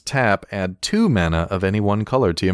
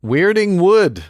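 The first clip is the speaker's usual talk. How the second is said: dramatic announcement; 12 semitones higher, 6 dB louder, slower than usual. Pretend. Card name announced with flourish.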